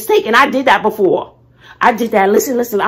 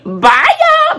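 singsong goodbye; 6 dB louder than usual. Real, not acted. Signing off to viewers.

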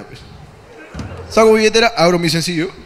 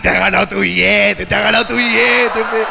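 mock grand generosity; 5 semitones higher than usual. Rewarding the mime to hide his fear, grandly. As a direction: play the big shot handing out cash.

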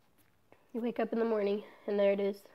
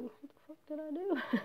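soft, higher, helpless aside; retold bewildered protest; 5 semitones higher, 8 dB quieter than usual. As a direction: ask it under your breath, baffled. Retold bewilderment at being accused.

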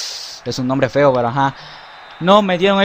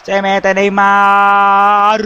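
excited commentary; 5 semitones higher, 4 dB louder, much slower than usual. Acted. Goal commentary opening.

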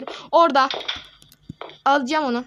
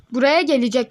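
louder, "Buraya" pushed up in alarm; rising alarm; 7 dB louder than usual. Real, not acted. Player warning viewers the enemy comes, alarmed.

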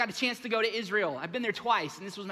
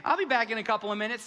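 cocky brush-off; 4 semitones higher, 6 dB louder than usual. Retold or imitated. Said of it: "I was like".

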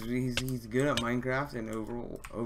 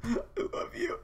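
giddy laugh; 4 dB quieter than usual. Real, not acted. Idol greets him in chat.

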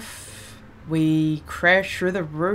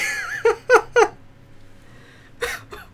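high, squealing laughter near tears; high laughing fit; 5 dB louder than usual. Real, not acted. Gamer losing it at the jokes, high laughing fit. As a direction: laugh until it almost cries.

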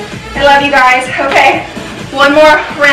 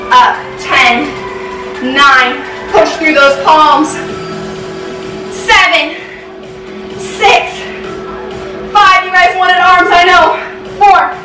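counting workout reps; 4 semitones higher than usual. Real, not acted. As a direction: push them through the last reps. Counting reps, pushing harder.

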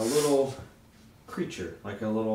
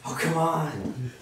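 coaxing goblin; 7 semitones higher, 3 dB louder, slower than usual. Acted. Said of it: "he goes".

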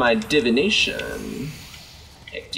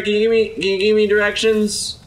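usual speaking voice

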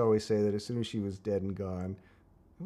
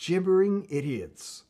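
announcing title; 7 semitones higher, 5 dB louder, slower than usual. Pretend.

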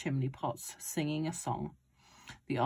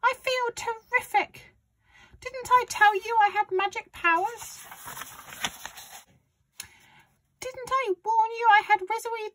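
very high, raised granny voice; boastful grandma; 5 dB louder than usual. A narrator's reading. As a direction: gloat and scold as old Grandma. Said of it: "she shouted".

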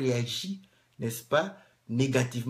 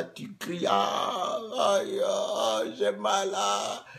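mock pain cries; 5 semitones higher, much slower than usual. Imitating a beaten person crying.

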